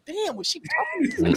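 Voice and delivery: high, laughing, unsure; high confused voice. Retold or imitated. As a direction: squeak it out in confusion. Acted confused DM sender.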